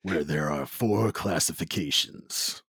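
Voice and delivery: low, measured movie-trailer narrator; grand, deliberate trailer delivery, low in pitch; movie guy voice. Acted. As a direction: intone it like a film trailer voice-over. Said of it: "decided to go with my movie guy voice".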